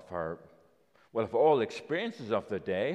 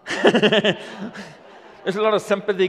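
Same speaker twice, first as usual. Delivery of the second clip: chuckling and much louder; laughing; 8 semitones higher, 12 dB louder than usual. Real, not acted. Laughing with the children about the child who tasted the salty water. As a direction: laugh through the words.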